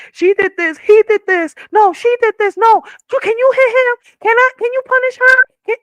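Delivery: put-on high, loud whiny kid voices; tattling kids. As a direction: squeal like siblings telling on each other. Kids tattling on each other.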